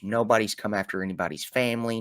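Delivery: ordinary talk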